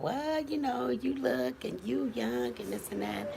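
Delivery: high mocking voice of dismissive people; high, sing-song mock of people brushing it off. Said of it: "they be like".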